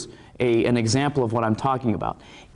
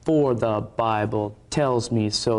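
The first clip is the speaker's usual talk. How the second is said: slow, measured chant, BIBLE landing on the beat; stress on the one beat; 3 semitones lower, slower than usual. Shown as a demonstration. Showing viewers where the emphasis falls, slow march-like stress. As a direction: plod through "Bible tells me so" on the downbeats. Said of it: "The emphasis is on the one beat".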